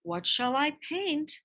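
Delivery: usual speaking voice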